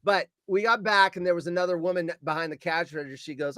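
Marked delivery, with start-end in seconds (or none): none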